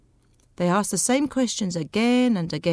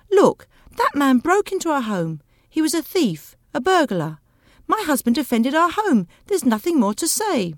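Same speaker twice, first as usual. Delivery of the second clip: higher, emphatic defence of her husband; indignant, higher-pitched protest; indignant wife; 7 semitones higher, 3 dB louder than usual. Acted. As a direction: insist firmly that the matter is closed. Daisy defends husband to police.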